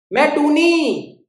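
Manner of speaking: high, drawn-out; bird answering. Acted.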